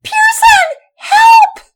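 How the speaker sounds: high, loud terrified scream for help; a sister shrieking for help from the cave mouth; frightened shriek. Acted. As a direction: scream his name in panic. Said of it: "he heard a shriek".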